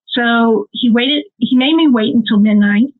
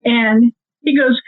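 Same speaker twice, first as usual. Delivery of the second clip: normal talking voice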